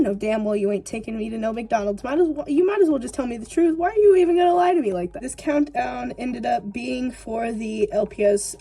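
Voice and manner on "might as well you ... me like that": exasperated. Pretend. Mock rant at parent.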